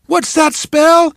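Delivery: high, loud, slow cheer; cheerleader call. Acted. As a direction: draw it out to rouse the crowd.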